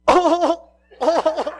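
high, loud pained "oh, oh" with a laugh; winded groan. Retold or imitated. Drunk man groaning after baton hits, high and loud. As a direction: sound like the wind got knocked out of you.

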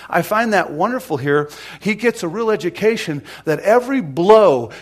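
loud, driving sermon delivery; full-force preaching. Retold or imitated. Preacher voices God's hurt for the persecuted, full-force preaching.

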